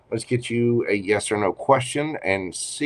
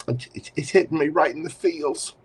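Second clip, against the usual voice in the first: choked up; 6 semitones higher than usual. Real, not acted. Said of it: "I'm getting choked up on that".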